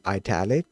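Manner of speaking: ITALIC said "eye-TAL-ic"; italic with long I. Retold or imitated. Coder telling viewers how people say the word, eye-TAL-ic pronunciation. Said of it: "prior to actually hearing people say the word".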